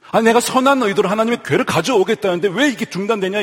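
mild mock protest, pitched high; acting out an aggrieved "why me"; indignant complaint. Voicing David's angry complaint to God.

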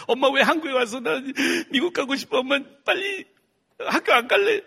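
put-on very high, loud crying kid; whining child. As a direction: wail the demand at mom. Child whining to his mother. (엄마에게 떼쓰는 아주 높은 아이 목소리)

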